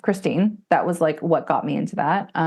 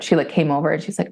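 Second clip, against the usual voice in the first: normal talking voice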